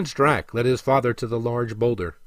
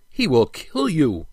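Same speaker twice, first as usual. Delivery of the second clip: slow, higher-pitched plea; pleading; 4 semitones higher, slower than usual. A narrator's reading. Prince warning his father he will be killed, pleading. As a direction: plead slowly, voice lifted. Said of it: "pleaded Drac".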